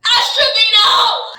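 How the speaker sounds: high, loud, drawn-out shout of the name. Real, not acted. Ranting about a hated player, loud drawn-out shout. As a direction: yell the name out long in disgust.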